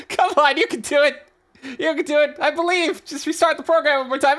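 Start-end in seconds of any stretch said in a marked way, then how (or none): none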